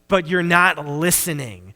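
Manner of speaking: ordinary talk, nothing marked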